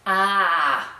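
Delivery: long open AH in place of R; R dropped to ah. Shown as a demonstration. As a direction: model AH slowly for the class.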